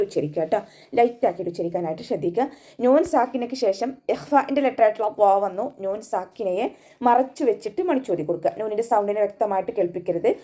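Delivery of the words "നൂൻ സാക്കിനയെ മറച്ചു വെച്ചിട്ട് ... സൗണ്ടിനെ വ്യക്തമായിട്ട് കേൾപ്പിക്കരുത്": plain reading voice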